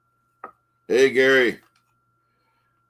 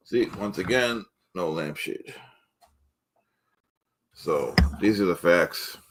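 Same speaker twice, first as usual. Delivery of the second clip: ordinary talk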